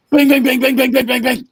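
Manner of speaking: loud, high imitated knocks; door banging. Telling friend about pounding on the door, loud mock knocks. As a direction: bang out the knocking.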